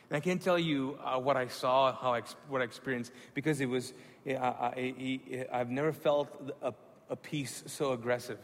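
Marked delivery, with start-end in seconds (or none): none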